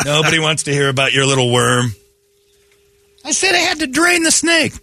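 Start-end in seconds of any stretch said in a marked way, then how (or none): 3.2-4.7 high-pitched put-on excuse; exaggerated high voice; mock protest. Acting the same mock protest.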